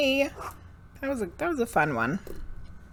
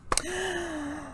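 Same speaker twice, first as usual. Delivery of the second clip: sharp gasp; 10 semitones higher, 9 dB quieter than usual. Real, not acted. Gasp before the reading.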